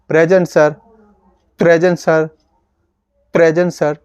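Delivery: loud classroom reply with a finger on the lips. Shown as a demonstration. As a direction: answer the roll call aloud. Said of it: "ऐसे मैं finger लगा के ये feel कर रहा हूँ".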